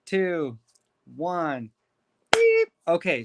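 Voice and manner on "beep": high sharp mock beep; imitated timer beep. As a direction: beep like a stopwatch going off.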